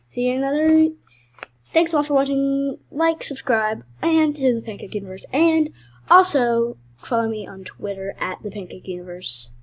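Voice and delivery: upbeat sign-off; bright fast channel outro; YouTube outro. Acted.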